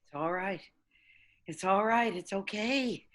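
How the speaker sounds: put-on calm the frightened child; gentle hushed reassurance; soothing. Mother soothing during violence.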